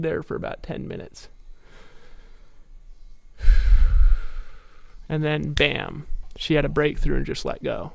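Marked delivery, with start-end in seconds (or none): 3.4-5.0 long audible exhale; loud sigh. Real, not acted. Releasing tension after the ledge story, sighing. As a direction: let out a heavy sigh.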